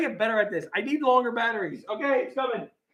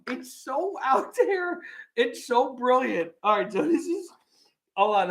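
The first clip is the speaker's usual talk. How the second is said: ordinary delivery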